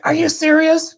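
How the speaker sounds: high, loud squeal of disbelief; wife can't believe how many points there are; shocked disbelief. Retold or imitated. Wife amazed at million points.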